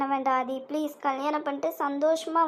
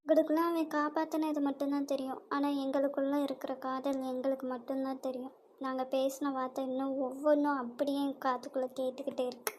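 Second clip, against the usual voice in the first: muttering to herself; 2 semitones lower, 9 dB quieter than usual. Acted. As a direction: say it under your breath to yourself. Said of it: "என்னடி வாய்க்குள்ளே முணுமுணுக்க?". (தனக்குள் முணுமுணுப்பு)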